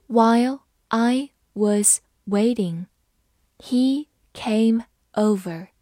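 slow and clear; slow reading. Shown as a demonstration. Teacher reading slowly for students, slow and clear. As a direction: read it slowly for learners. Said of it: "好，慢慢来读。".

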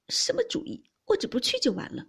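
high, loud dismissive question; stubborn, indignant refusal; defiant retort. A narrator's reading. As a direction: throw it back defiantly. Angry maid rejects friends' coaxing.

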